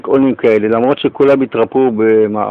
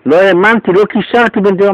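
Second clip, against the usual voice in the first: much higher and louder, rushing; excited disbelief; 9 semitones higher, 5 dB louder than usual. Retold or imitated. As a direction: burst out in amazement. Host re-enacts his own amazement. (התלהבות גבוהה וקולנית)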